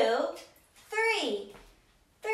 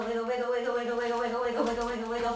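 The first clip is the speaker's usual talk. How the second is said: ordinary talk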